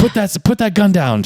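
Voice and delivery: high, urgent command; mock robber's order. Robber to the security guard, mock urgent command. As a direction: act out an agitated gunman's demand.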